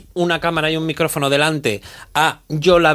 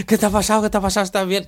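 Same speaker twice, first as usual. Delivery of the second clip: worried presenter; 5 semitones higher, faster than usual. Retold or imitated. The presenter rushing over, worried.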